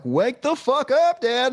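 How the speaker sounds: put-on high, drawn-out kid yelling for Dad; exaggerated slow, high-pitched wake-up call; mock kid's demand. Dad imitates his kid waking him, high mock yell. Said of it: "My kid was like".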